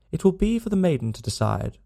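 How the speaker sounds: a hint of a smirk in the reply; smirking rejoinder. A narrator's reading. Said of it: "rejoined young Drebber, smirking".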